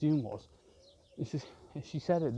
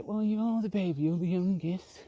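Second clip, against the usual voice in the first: higher, louder teasing family voice; lightly mock a sibling calling him the baby; mock family member; 5 semitones higher, 4 dB louder than usual. Family teasing the youngest about being the baby. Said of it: "you go".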